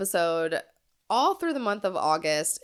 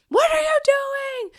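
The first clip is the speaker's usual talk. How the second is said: put-on high, loud panicked shriek of a small child; a child's frightened scream over the scissors; 6 dB louder than usual. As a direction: shriek it like a terrified four-year-old. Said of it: "screamed, cried".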